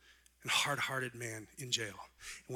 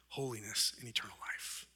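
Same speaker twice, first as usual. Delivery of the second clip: whispered; 3 semitones lower, 7 dB quieter than usual. Real, not acted. Soft drop in list.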